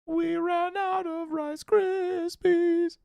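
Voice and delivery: very high, very slow squeaky voice; silly put-on voice. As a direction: stretch it out in a high cartoon voice. Putting on a silly voice.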